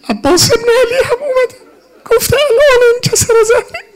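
very high, tearful wail; sobbing rowzeh cry; weeping lament. Real, not acted. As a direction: cry out in grief for the fallen. Preacher weeps at Abbas's death.

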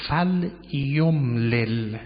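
very slow, syllable by syllable; slow, deliberate Arabic enunciation; careful sounding out. Shown as a demonstration. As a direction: stretch each syllable for the class. Teacher sounds out Quranic word.

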